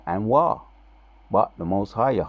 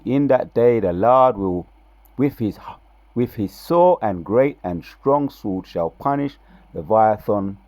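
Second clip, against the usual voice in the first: louder, higher preaching of scripture, dragging the key words; 3 semitones higher, 5 dB louder than usual. Acted. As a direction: proclaim the prophecy like a preacher.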